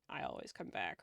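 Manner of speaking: low, quiet, bad French accent; half-hearted French accent line. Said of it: "I don't know how to do a good French accent".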